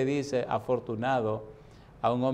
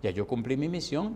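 normal talking voice

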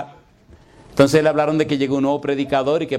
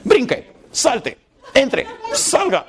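high, drawn-out mock orders; mock commands; 9 semitones higher, much slower than usual. Congregation ordering their pastor around, mock-bossy. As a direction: order someone about, each word slow and high.

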